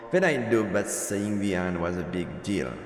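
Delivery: ordinary delivery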